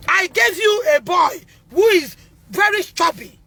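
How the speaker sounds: real sobbing cry about her son. Real, not acted. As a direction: break into a tearful shout. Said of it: "cry shout".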